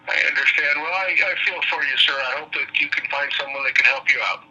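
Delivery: usual speaking voice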